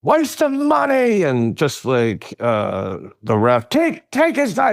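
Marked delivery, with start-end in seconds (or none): 0.0-1.2 high, slow, stretched-out yell of an angry fan; hammed-up fan's cry; mock fan yell. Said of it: "he's screaming about this game". 3.7-4.7 high, strained fan shout at the ref; imitating an angry fan's shout; mock fan yell. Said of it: "he's screaming about this game".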